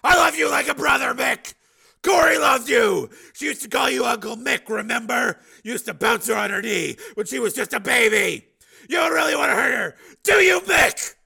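very high, loud appeal; pleading shout. A narrator's reading. Steel appealing to his old partner, shouting. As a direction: plead across the distance.

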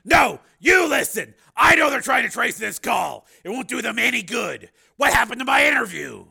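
high, shouted demands; angry confrontation. A narrator's reading. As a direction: cut in forcefully and demand answers. Steele angrily cuts Linda off.

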